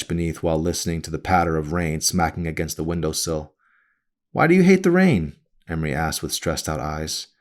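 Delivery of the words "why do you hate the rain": higher, concerned mother asking her daughter; a worried mother, pitched up; mother's voice. A narrator's reading. Mother's voice, high.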